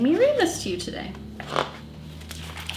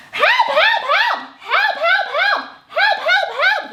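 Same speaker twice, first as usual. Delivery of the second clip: very high, squeaky mock cries for help, laughing; mock panicked cries. People crying help in song.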